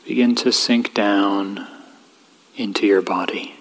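calm, even voice with long pauses; guided meditation. Real, not acted. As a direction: gently guide them inward, leaving space between phrases.

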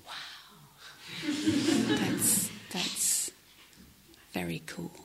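very soft, halting, impressed thought; hushed awe. Retold or imitated. Newcomer silently marvels at an experienced meditator, hushed awe. Awed private thought watching the man.

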